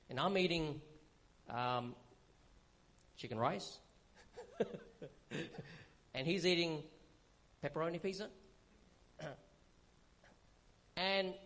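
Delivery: ordinary talk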